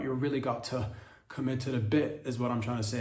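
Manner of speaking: usual speaking voice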